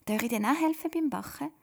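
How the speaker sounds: hohe, lebhafte Kinderstimme; eifriges Kind. Acted. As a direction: frag eifrig wie ein kleines Mädchen. (Rachel fragt die Mama eifrig, hohe Kinderstimme)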